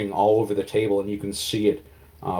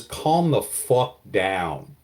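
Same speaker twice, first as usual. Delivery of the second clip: slow command; much slower than usual. Acted. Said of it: "and says".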